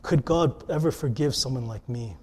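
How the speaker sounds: low, soft, humbled plea; suddenly serious. Retold or imitated. One caller turning the whole show, serious and hushed. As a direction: drop to a hushed, honest question. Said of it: "to all of a sudden someone said".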